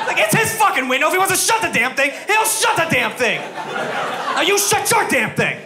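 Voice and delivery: high, slow, laughing tough-guy voice; hammed-up high New Yorker rant. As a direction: lay down the law like a brash New Yorker. Voicing the New Yorker defending him.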